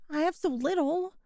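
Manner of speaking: usual speaking voice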